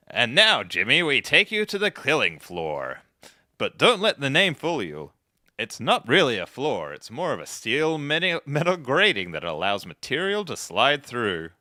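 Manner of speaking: a hammed-up showbiz presenter introducing a segment; Troy McClure. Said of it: "he was playing Troy McClure".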